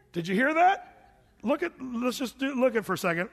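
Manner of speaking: ordinary delivery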